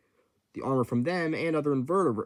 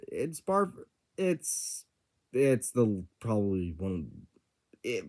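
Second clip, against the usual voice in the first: stammering, restarting the words; stuttering; 3 semitones lower, 4 dB quieter, slower than usual. Real, not acted. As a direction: trips over the start of each word. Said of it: "sorry for my stuttering".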